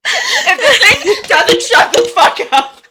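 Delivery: very high, loud and slow through laughter; real laughing outburst; laughing exasperation. Real, not acted. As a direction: squeal the threat while cracking up. Exasperated amid hysterical laughter.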